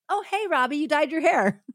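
high, chirpy mock hello; casual surprised greeting. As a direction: greet a coworker with mild surprise. Acts coworkers greeting Robbie.

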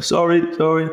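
high-pitched sheepish mock apology; meek apology. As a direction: apologise meekly, pitched right up, like a scolded junior. Meek apology to scolding senior rider.

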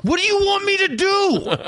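very high, slow, strained voice; exasperated plea. Retold or imitated. Dad holding his crying son's face, exasperated high plea.

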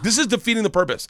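high-pitched retold traffic gripe; exasperated complaint. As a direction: act out someone fed up with traffic. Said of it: "And you're like".

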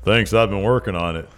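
put-on Southern accent in character. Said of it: "that's a really good Southern accent".

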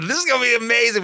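high, loud and fast; hyped-up exclamation. Retold or imitated. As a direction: rush it out thrilled.

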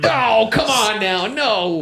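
high, drawn-out objection; exasperated protest. Real, not acted. Podcaster objecting to the rival nominee, exasperated. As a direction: plead against it, slow and high-pitched.

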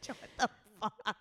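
ordinary talk

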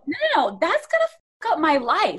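high-pitched, pleading objection; hammed-up parental panic; mock panicked protest. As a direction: protest in comic alarm. Acting out panic at losing iPad.

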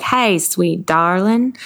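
slow, drawling Southern endearment; sweet-talking Southern granny; Southern grandparent. Acted. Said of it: "grandma, grandpa voice 'cause they all call me".

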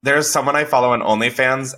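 ordinary talk, nothing marked

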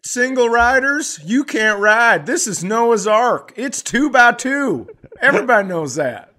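high, loud bossy announcer; hammed-up ride attendant. Attendant turns away single riders, loud and high. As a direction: lay down the rules like a park worker.

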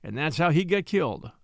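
usual speaking voice

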